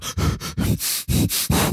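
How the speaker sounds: wheezy huffs like a pug; loud heavy breathing. Shown as a demonstration. Kid after running up the stairs, noisy heavy breathing. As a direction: pant noisily through the mouth. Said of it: "like, really loud".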